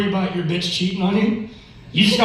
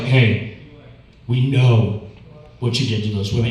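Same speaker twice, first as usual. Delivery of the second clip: low prosecutor voice; 7 semitones lower than usual. Retold or imitated. Killer cross-examining himself.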